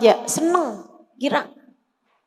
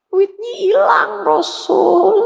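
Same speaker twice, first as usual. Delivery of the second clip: put-on high, slow, crying child's voice; tearful child; 6 semitones higher, slower than usual. Slave girl crying to the Prophet about her lost money, high and tearful. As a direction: sob it out like a little girl.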